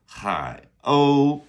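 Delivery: "Hai o" with airy H and high, loud level o; aspirated H. Shown as a demonstration. Teacher repeating seagull in Chinese, aspirated H. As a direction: puff the H of "Hai o", hold the o high. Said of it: "Another aspirated H".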